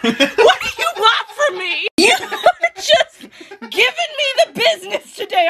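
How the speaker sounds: high, exasperated laughing complaint; laughing protest. Real, not acted. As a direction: protest through the giggles.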